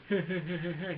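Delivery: rapid chuckling put-on laugh; mock alien giggle; imitated alien laugh. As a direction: chuckle in a quick stuttering run. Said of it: "he laughs like one of my friends".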